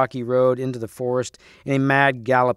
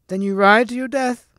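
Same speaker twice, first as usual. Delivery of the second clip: very high, cracked hag voice; high hag threat, rising at the end; chuckling loathly lady; 10 semitones higher than usual. A narrator's reading. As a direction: taunt in a shrill crone voice. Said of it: "chuckled the loathly lady".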